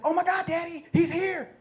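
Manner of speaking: put-on high, excited little girl voice; excited little daughter. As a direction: squeal it like a thrilled kid.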